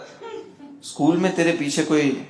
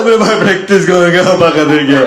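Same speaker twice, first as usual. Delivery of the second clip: loud, put-on voice of a kid sobbing out the news; crying while speaking; 3 semitones higher, 7 dB louder than usual. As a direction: blurt it out through mock tears. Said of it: "वो रोते रोते बोल रहा है". (रोते रोते, ज़ोर से बताया गया)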